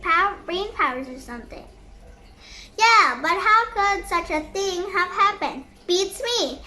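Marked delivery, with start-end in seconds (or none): none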